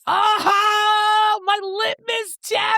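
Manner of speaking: very high, stretched-out squeal of delight; ecstatic squeal. Real, not acted. Host learning her friend read them all, ecstatic squeal. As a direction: cry out in high-pitched triumph.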